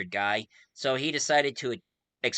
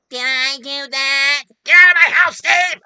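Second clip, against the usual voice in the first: high, loud sitcom squawk; loud imitation, pitched way up; Steve Urkel; 14 semitones higher, 15 dB louder than usual. Quoting Family Matters catchphrases, loud high impression. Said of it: "Steve Urkel around going like".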